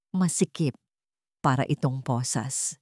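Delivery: plain reading voice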